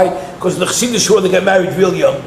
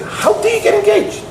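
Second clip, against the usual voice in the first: higher-pitched mock outrage; indignant rebuke; 12 semitones higher than usual. Yeshiva guy confronts the newly engaged teller, imitated higher outrage. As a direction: retell the yeshiva guy's outraged challenge. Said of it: "He said".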